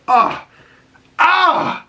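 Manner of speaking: high-pitched exasperated "ah"; anguished groan. Real, not acted. Host reacts to Joel's last words, anguished cry. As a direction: cry out as if the story hurts. Pained reaction to the sad scene.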